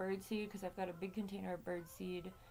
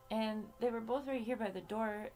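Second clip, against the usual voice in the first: normal talking voice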